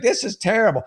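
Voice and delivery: a high, indignant cry; disgusted with the mess; angry mom. Retold or imitated. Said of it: "So she's just furious".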